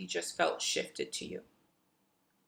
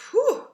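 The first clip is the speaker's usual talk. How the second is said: high whew; 11 dB louder than usual. Real, not acted. Release exhale.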